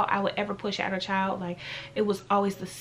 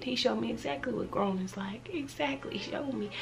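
soft rueful aside; 7 dB quieter than usual. Real, not acted. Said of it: "self-deprecating aside".

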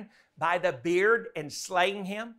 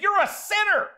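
insistent accusation; 11 semitones higher, 5 dB louder than usual. Retold or imitated. Baptist guy insisting in argument.

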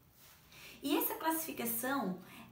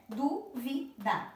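"Dúvida" slowly split into dú, vi, da; syllable by syllable; much slower than usual. Shown as a demonstration. Teacher counting three syllables for pupils, syllable by syllable. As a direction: give each syllable of "Dúvida" its own beat. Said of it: "tem três sílabas".

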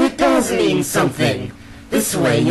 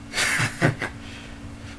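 villain chuckle; 8 dB quieter than usual. Acted. Villain mocks the hero.

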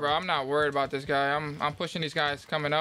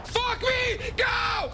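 frustrated shout; much slower than usual. Real, not acted. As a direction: yell it out in frustration. Killed by helicopter, yelling.

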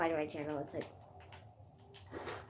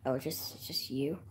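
relieved whisper; 5 semitones lower, 6 dB quieter than usual. Real, not acted. Spots someone in the game.

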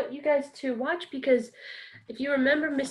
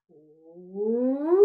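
Papa Bear slide; 4 semitones lower, 5 dB louder than usual. Shown as a demonstration. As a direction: slide the voice up from the bottom. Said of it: "we're gonna start in Papa Bear".

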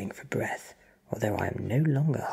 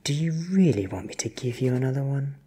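menacing; 4 semitones higher, 6 dB louder, faster than usual. Acted. Vampire threatens captive.

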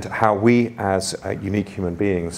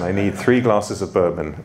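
ordinary talk, nothing marked

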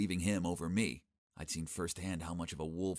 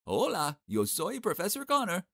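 showy Spanish greeting; 11 semitones higher, 5 dB louder than usual. Acted.

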